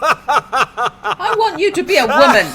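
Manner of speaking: talking through loud laughter; real laughter breaking the words; laughing. Real, not acted. Player laughs at the reveal.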